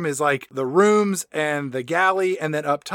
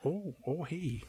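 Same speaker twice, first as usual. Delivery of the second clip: very soft, taken-aback murmur; hushed laid-back movie star impression; Owen Wilson; 2 semitones lower, 16 dB quieter than usual. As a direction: trail it out quietly, flustered and interested. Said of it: "and Wilson says".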